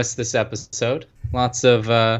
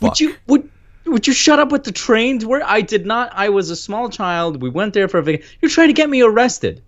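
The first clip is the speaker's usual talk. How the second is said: flustered; 9 semitones higher than usual. Real, not acted. Flustered denial of Pakistan jabs.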